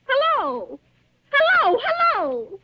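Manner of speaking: high, loud, slow hellos; desperate calling. Acted. Woman pleading with a silent caller, slow high hellos. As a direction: stretch each hello, pleading for an answer.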